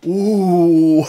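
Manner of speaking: loud, high mock gasp of the crowd; a whole store going ooh at once. Store customers react to his outburst, loud high ooh. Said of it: "everyone in the store went".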